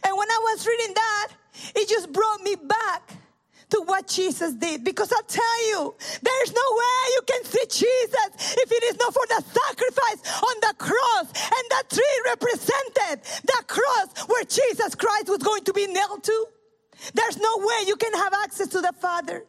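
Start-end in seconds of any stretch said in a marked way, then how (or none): 4.8-15.0 impassioned preaching shout. Real, not acted. Preacher to the congregation about the cross, impassioned tearful shout. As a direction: cry it out to the congregation with fervour.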